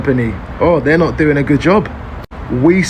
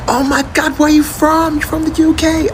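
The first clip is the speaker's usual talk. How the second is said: high, gushing imitation of an excited American greeter; 13 semitones higher than usual. Americans hearing a London accent, imitated high and gushing. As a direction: squeal it like a thrilled stranger meeting a Londoner. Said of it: "they're like".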